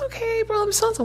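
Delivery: friendly stranger at the gate, very high; chirpy stranger. Retold or imitated. Lady introducing herself, voiced.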